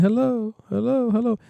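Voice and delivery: high hellos repeating; longer echo hello. Shown as a demonstration. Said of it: "Up here to be like".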